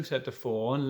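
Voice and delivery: plain reading voice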